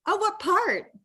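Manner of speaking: high, eager question; bright high curiosity, leaning in; excited asking which part. Real, not acted.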